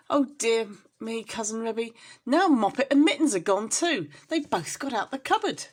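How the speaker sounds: anguished cry; panicked mother cat. Acted. Mother cat panicking over kittens.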